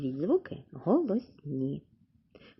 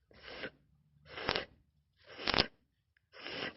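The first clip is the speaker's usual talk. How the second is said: soft hissing С in separate bursts; quiet, repeated "sss"; imitated snake hiss; 12 dB quieter than usual. Shown as a demonstration.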